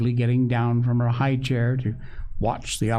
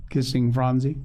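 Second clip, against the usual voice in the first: usual speaking voice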